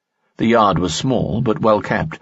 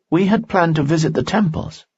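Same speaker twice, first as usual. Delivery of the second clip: higher young woman's voice, lightly voiced; worried young woman, pitched up; Dina's voice; 11 semitones higher than usual. Dina speaks in story.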